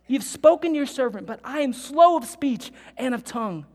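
protesting Moses. Acted.

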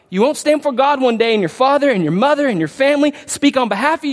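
normal talking voice

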